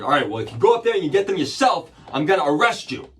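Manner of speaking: loud, high, fast imitation of an irate officer; mock an angry cop laying down the law; angry cop's threat. Cop threatens arrest in retold story.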